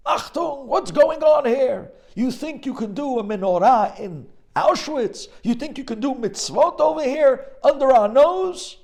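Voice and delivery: furious high shouting guards; enraged Nazi soldiers yelling; screaming soldiers. Retold or imitated. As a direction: roar it at the prisoners. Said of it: "they start yelling and screaming".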